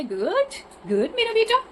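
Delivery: very high, soft cooing praise. Real, not acted. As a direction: owner cooing praise to her little bird. Praising pet bird softly.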